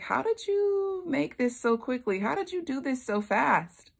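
high-pitched, disbelieving questioning; doubting. Acted. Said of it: "So Isaac is doubting".

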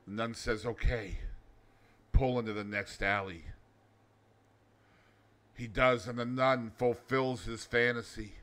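understated joke teller; joke narration. Acted. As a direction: tell the joke low and steady. Reads joke as low narration.

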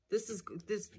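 breathy whispered aside; whispering. Real, not acted. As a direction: drop to a whisper.

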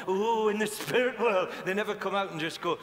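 usual speaking voice

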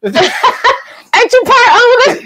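slow, high, laughing tease. Real, not acted. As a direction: tease him through laughter. Teasing a co-host mid-laugh.

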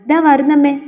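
understated stifled call; muffled call out. A narrator's reading. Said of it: "അടച്ച ശബ്ദത്തിൽ ഉറക്കെ പറഞ്ഞു".

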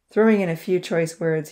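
usual speaking voice

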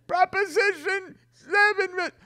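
high, loud, put-on panicky voice; mock panic. Panicked believer lamenting the vote, high and loud. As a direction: wail it like disaster struck. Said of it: "They're always panicking".

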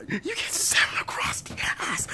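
hissed, breathy menace; whispered threat. Acted. Thug threatening the kid, whispering.

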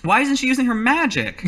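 high-pitched acted demand; exasperated. Acted quarrel, [name] argues back.

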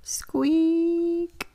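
a tiny creaky bed squeak, high and breathy; high whispered squeak. Acted. The tiny bed squeaking under father bear.

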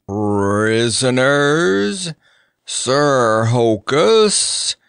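very slow, drawn-out call; shouted announcement. A narrator's reading. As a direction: call it out, stretching every word. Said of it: "shouted the chief poker".

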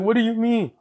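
high, startled question; confused and scared. Acted. Deku, scared, asks his friends what they mean, high and startled. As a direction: ask it jumpy and lost, voice shooting up. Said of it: "Deku, confused, scared".

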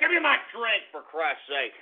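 normal talking voice

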